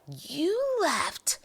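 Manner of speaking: hammed-up hurt complaint; heartbroken outrage. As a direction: act personally betrayed, playing it up. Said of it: "it just shattered my heart".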